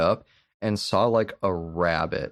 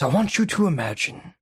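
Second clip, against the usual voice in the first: hammed-up Daniel Plainview; 9 semitones higher than usual. Retelling the milkshake speech to his co-host, hammed-up and gloating. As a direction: savour each word like a gloating villain. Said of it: "He goes".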